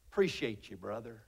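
low, quiet offhand reply; imitating reticent relative. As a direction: imitate a relative dodging "love", dropping low and soft. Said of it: "This is how they said".

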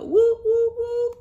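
loud, high woo woo like a train whistle. Acted. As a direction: blow the whistle with your voice.

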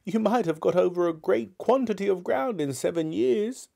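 put-on high-pitched old miser; reasoning Scrooge. Scrooge questioning the ghost's travels, high-pitched.

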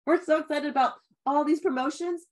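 high, loud excited gabbling; overexcited babble. Retold or imitated. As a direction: rattle it off all worked up. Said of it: "Sometimes we get so excited".

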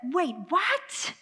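usual speaking voice

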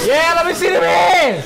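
full shout. Real, not acted. Friend cheering the Lions walkout, full hype yell. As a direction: belt it out to the whole room.

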